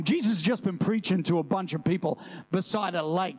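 ordinary delivery

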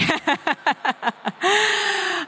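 a quick, high-pitched laugh breaking out; short laugh. Real, not acted. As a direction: laugh off your own joke lightly. Laughing after the lawsuit joke.

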